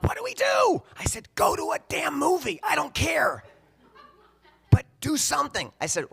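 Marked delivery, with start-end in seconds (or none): 0.0-0.8 very high, loud wail of alarm; panicked shout. Retold or imitated. Mom panicking on the phone. 1.3-3.4 loud, high-pitched outburst; exasperated shouting. Retold or imitated. Exasperated shouting match with mom. 4.7-5.7 loud, high demand; exasperated shouting. Retold or imitated. Exasperated shouting match with mom.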